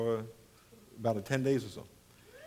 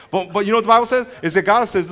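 ordinary talk

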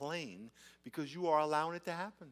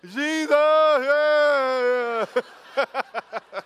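very long, high holler; mock hearty shout back, stretched out; yell across parking lot; 10 semitones higher, 13 dB louder, much slower than usual. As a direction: holler it loud as if across a car park. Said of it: "yelling across the parking lot".

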